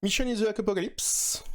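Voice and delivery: Mission in the Apocalypse pitched high in a self-mocking English accent; hammed-up English accent. Shown as a demonstration. Joking to viewers about his superbe accent, high hammed-up English. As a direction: show off a proudly bad English accent. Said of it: "mon superbe accent".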